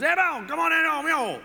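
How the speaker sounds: high, loud Cajun yell; Cajun neighbor. Retold or imitated. Cajun neighbor calls the pastor over from the yard, high Cajun holler. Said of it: "that was my neighbor".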